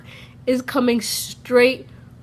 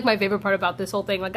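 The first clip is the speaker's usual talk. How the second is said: ordinary talk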